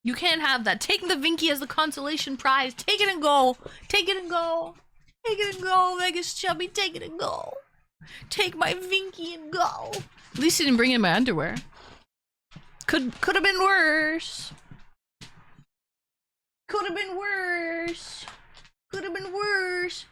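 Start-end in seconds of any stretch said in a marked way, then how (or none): 0.8-9.9 higher coaxing voice for the dog. Real, not acted. 12.9-20.0 slow resigned murmur. Real, not acted. Sighing resignation, drawn out.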